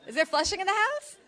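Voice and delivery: rally the Queens crowd; calling out to the crowd. Real, not acted. Calling out to audience.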